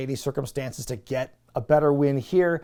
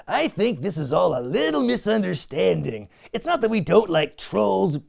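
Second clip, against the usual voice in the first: Triple H voice; 4 semitones higher, 3 dB louder than usual. Retold or imitated. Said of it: "Triple H goes".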